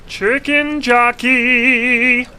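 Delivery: high, slow, drawn-out yell of Chicken Jockey; shouted. Acted. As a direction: scream the name out long and loud. Says the name twice differently.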